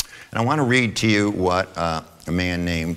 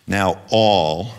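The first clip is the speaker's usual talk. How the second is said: "all" hit louder; stress on all; 2 semitones higher, 5 dB louder than usual. Shown as a demonstration. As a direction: lean hard on the word "all". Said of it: "I emphasize the word".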